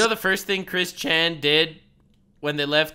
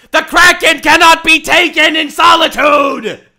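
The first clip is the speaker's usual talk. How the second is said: hammy shouted declamation; 9 semitones higher, 13 dB louder than usual. Pretend. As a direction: bellow it like a bad villain. Hammy Chris-style war declamation.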